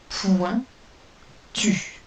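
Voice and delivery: correcting by splitting Point, tu; syllables split. Shown as a demonstration. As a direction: Point then tu with a pause between. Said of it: "Donc première syllabe".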